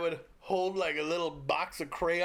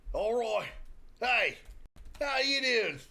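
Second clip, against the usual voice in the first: high, punchy mock-Australian greeting; Aussie accent; 4 semitones higher, slower than usual. Shown as a demonstration. Showing off accents to the co-host, hammy Aussie accent. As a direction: call out in a put-on Aussie accent. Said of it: "I do Aussie".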